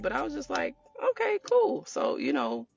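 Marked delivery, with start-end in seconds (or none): 1.1-1.8 Okay, cool, chirped up high; high mock-cheerful okay cool; mock agreeable. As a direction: brightly go along with it, pitched high. Quoting her own compliant reply.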